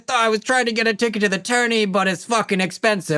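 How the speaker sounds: high, fast chatty cabbie voice; a breezy water-taxi driver shooting the breeze; chatty cabbie Jerome. Acted.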